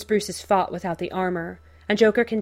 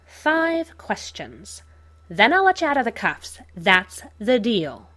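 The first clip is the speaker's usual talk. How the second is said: Joker voice; 4 semitones higher, 4 dB louder than usual. Acted. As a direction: spell out the deal like a showman villain. Joker setting terms.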